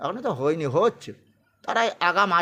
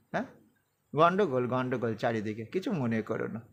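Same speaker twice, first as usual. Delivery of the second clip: quiet aside; 8 semitones lower, 7 dB quieter than usual. Real, not acted. Aside about noise around.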